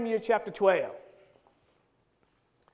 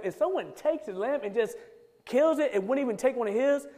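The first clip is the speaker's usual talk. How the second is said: ordinary delivery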